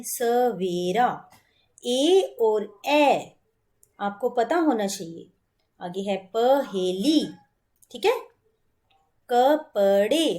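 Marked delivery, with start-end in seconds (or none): 0.0-1.3 सवेरा drawn out slowly with a clear closed e; teacher slowly models सवेरा for children; slow careful pronunciation. Shown as a demonstration. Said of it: "क्या बोलते हैं?". 9.3-10.4 कपड़े stretched slowly, ending on a long e; teacher drawing out कपड़े for the class; slow model word. Shown as a demonstration. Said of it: "हम कपड़े नहीं बोलेंगे".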